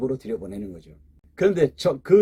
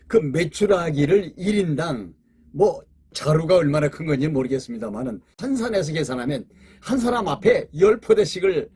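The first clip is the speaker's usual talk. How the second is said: ordinary talk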